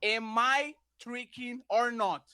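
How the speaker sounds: slow, pointed question. Shown as a demonstration. Demanding a straight answer from the opponent, very slowly. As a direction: draw the question out word by word. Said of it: "I'm going to repeat it very slowly".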